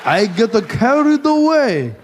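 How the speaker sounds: put-on Japanese accent.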